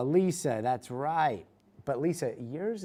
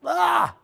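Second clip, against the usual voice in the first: sudden high shriek, much louder; a loud comic yelp to build the reveal; mock shriek; 13 dB louder than usual. Dramatic reveal of the injury.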